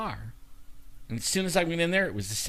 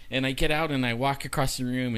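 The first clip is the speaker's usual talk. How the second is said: ordinary talk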